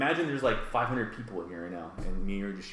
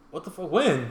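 high stunned outcry; shocked outburst; 9 semitones higher, 5 dB louder than usual. Retold or imitated. Hearing of coronavirus case there.

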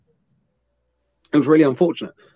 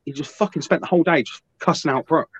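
ordinary talk, nothing marked

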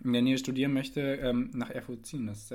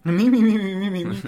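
loud whiny mi mi mi; mock whining; 7 semitones higher, 12 dB louder than usual. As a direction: join in the mocking whine. (lautes spöttisches Jammern)

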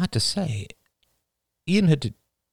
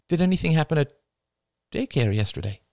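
higher, careful motherly question; gentle mother; 9 semitones higher, faster than usual. A narrator's reading. Mother questioning her son, higher and gentle.

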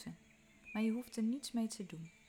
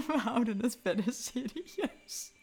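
laughing; 3 semitones higher, 9 dB louder than usual. Real, not acted. Laughs at own joke.

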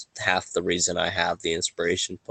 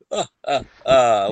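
mock laugh; 5 semitones higher, 4 dB louder than usual.

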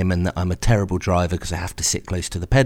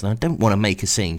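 usual speaking voice

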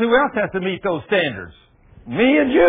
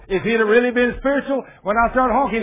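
ordinary talk

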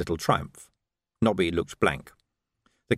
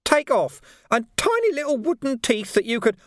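a hint of a cheeky Nobby, higher; lightly chirpy street lad; lightly voiced street urchin; 13 semitones higher than usual. A narrator's reading. A cheeky street lad prattling on to the Major in a higher voice.

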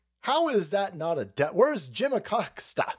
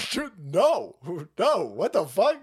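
normal talking voice

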